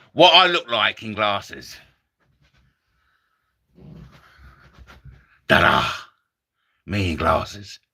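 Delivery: low, mumbling conjurer voice; a hint of Tommy Cooper. Comic as Tommy Cooper showing off glasses, low. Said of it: "my Tommy Cooper impression".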